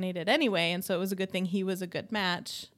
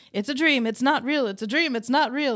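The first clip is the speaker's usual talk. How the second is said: higher, louder chanted mock yell; imitating someone yelling over and over; retold yelling; 5 semitones higher, 5 dB louder than usual. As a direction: repeat it urgently in a half-hearted yell. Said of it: "he keeps yelling".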